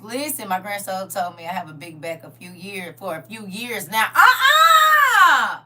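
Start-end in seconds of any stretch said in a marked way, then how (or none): 4.1-5.6 long, high, loud "uh-uh" shriek; outraged squeal. Real, not acted. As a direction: squeal your protest at full volume. Outraged outburst at grandchild's remark.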